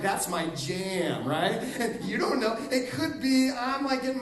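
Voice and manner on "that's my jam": slow, grooving to a song; slow delight at a radio song; slow, enjoying that's my jam. Shown as a demonstration. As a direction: savour it like a favourite song. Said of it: "song on the radio".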